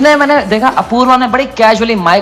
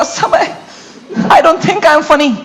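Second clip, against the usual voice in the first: mock tearful; 3 semitones higher, much slower than usual. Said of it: "उसने रोते रोते बोला".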